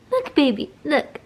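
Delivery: high sing-song coaxing. Real, not acted. Getting baby to look, cooing.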